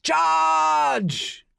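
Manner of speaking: very high full-throated yell; all-out battle cry; top of his voice. A narrator's reading. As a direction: roar it to drive the horse on. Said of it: "shouts at the top of his voice".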